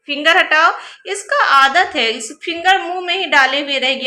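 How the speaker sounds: 3 tell her off to take the finger out; 4 mother scolding her daughter to take the finger out, loud and firm. Real, not acted. Scolding child for finger in mouth.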